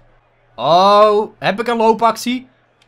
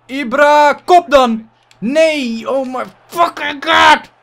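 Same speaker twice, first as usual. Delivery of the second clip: frustrated shout; 7 semitones higher, 6 dB louder, slower than usual. Real, not acted. Furious at missed header.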